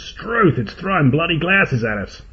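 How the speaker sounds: panicked Australian voice, high and startled; startled Aussie shout. A narrator's reading. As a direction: squawk it out like a bloke under attack. Attacked man cries out.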